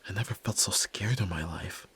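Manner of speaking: whispered, still-shaken admission; frightened whisper. Acted. Son admitting how scared he was, whispered. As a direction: confess the fear under your breath.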